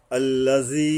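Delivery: আল্লাজি with a thin, light ذ sound; আল্লাজি said carefully, tongue tip at the teeth; tajweed demo. Shown as a demonstration. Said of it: "পাতলাভাবে জাল".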